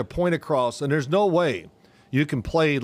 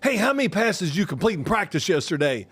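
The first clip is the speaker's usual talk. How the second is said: a pointed, raised question; imitating a grilling coach; 5 semitones higher than usual. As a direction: coach grilling his quarterback about yesterday's practice. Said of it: "He goes".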